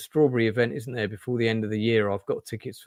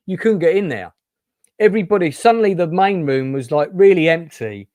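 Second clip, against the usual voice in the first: excited; 6 semitones higher, 8 dB louder than usual. Real, not acted. Excitedly recalling packed room.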